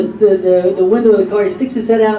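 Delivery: usual speaking voice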